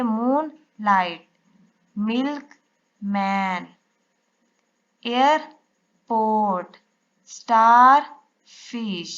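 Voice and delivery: मूनलाइट, मिल्कमैन, एयरपोर्ट, स्टारफिश said very slowly, part by part; split into syllables. Shown as a demonstration. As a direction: read each compound word in two slow halves. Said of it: "यहाँ से divide करेंगे". (हर शब्द को दो हिस्सों में धीरे-धीरे)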